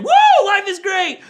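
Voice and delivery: put-on high, loud, slow cheer of a happy kid; gleeful woo. Pastor imitating a kid who thinks life is great. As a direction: whoop it like life is perfect. Kid's mood swings, acted high.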